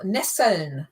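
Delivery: Nesseln with the L sounded, NESS-eln; clear L. Shown as a demonstration. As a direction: correct the learner with a careful L in Nesseln. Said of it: "that's an L".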